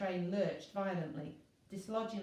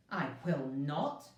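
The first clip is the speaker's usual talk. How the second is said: indignant refusal; 4 semitones lower, 3 dB louder, slower than usual. A narrator's reading. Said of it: "said the indignant Constellation".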